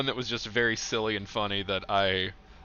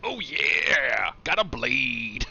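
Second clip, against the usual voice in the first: slow, gloating dark villain voice; necromancer voice; 3 semitones higher, 4 dB louder, much slower than usual. Acted. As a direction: gloat over the loot like a necromancer.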